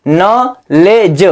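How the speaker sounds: "नॉलेज" stretched very slowly, no k, no d; slow pronunciation demo. Shown as a demonstration. Teacher showing the silent d, slow "नॉलेज". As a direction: draw out "नॉलेज" syllable by syllable. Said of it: "डी का भी उच्चारण नहीं हुआ".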